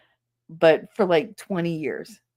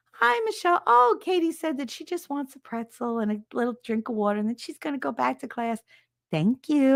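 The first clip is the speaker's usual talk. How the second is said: Nurse Helen's voice; 6 semitones higher than usual. Retold or imitated. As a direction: greet sweetly like a calm school nurse.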